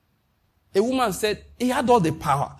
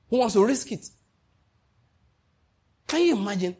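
ordinary talk

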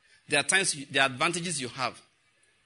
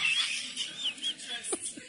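a high laugh breaking out; 4 dB quieter than usual. Real, not acted. Preacher laughing at his own joke, high laugh. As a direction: laugh at the punchline.

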